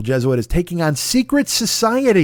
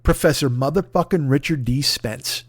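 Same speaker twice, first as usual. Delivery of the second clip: usual speaking voice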